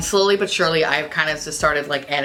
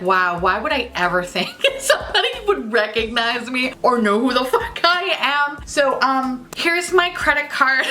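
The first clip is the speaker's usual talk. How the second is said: laughing self-mockery; 6 semitones higher than usual. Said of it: "I was like".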